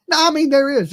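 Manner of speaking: normal talking voice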